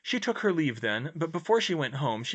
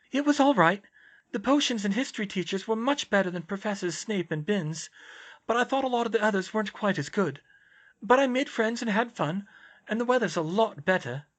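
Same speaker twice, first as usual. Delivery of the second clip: higher-pitched character voice, earnest; raised, sincere dialogue voice; earnest young heroine; 10 semitones higher, 4 dB louder than usual. Acted. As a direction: voice the concerned friend, pitched up.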